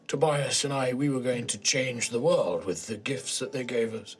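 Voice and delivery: halting, regretful villain mourning lost work; broken old man. Acted.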